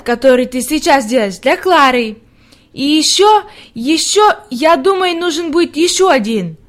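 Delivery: put-on little girl thinking aloud; eager little girl Heidi. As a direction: voice an eager child making plans. Plays young Heidi talking.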